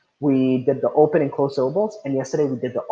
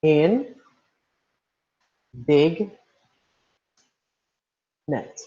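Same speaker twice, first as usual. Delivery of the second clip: "In", "big", "net", each alone with long pauses; syllable by syllable; much slower than usual. Shown as a demonstration. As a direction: sound out "In … big … net" one syllable at a time. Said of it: "How many syllables can we separate".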